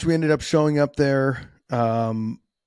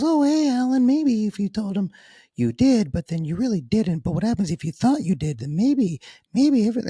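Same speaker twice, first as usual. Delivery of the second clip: put-on much higher, teasing childish inner voice; inner immature voice; 9 semitones higher than usual. His stupid inner voice needling him during the lie detector test, high and teasing. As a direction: play the silly little voice in his head, pitched way up. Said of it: "little inner stupid, immature voice that goes".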